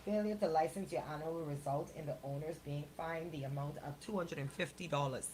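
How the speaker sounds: ordinary delivery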